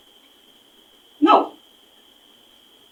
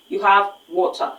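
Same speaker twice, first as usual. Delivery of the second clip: normal talking voice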